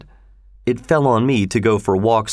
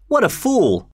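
high-pitched gleeful jeer; giggly mockery; 10 semitones higher than usual. A narrator's reading. Linton jeering at Hareton, mocking. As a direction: pitch it up and poke fun. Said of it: "Linton giggled".

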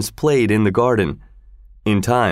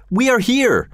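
yelling; 14 semitones higher than usual. A narrator's reading. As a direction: call out so he can find you. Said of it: "Lorenzo yelled back".

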